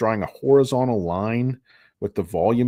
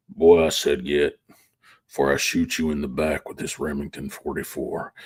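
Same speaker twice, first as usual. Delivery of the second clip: Sam Elliott impression; 8 semitones lower than usual. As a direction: menace the kid like a gruff old rancher. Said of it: "my Sam Elliott impression".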